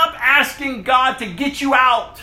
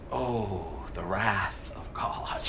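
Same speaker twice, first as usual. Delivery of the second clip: whisper; 13 semitones lower, 12 dB quieter, slower than usual. Real, not acted. Awed line about God's wrath.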